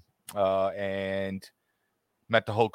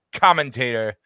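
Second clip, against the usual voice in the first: ordinary talk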